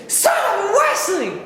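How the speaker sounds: high, pained yelp; pained scream. Retold or imitated. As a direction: cry out after getting shot. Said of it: "I just scream".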